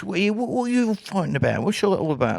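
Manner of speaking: mock English accent, pitched high; high-pitched take on an English accent; English kid. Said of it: "the English kid".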